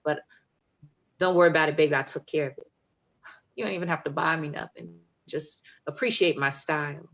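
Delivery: bold, rhythmic poetry performance with swagger; spoken-word poem. Acted. Poet performing Proper Love for the audience, confident spoken word. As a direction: perform it proud and playful, like a love demand.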